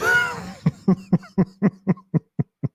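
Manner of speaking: real high laughing fit; big laugh. Real, not acted. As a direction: laugh long and high at yourself. Laughing at his own bad impression.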